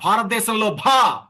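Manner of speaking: teacher modelling a strong aspirated భా; forceful aspirate. Shown as a demonstration. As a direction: drive the breathy భా out from the stomach. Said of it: "భా అనండి అందరూ గట్టిగా".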